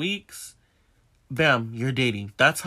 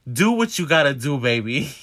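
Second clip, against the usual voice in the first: louder, hammed-up lovestruck go-ahead; mock lovestruck; 6 dB louder than usual. So in love with him, mock lovestruck. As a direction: play the smitten fool, loudly. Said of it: "so in love with him".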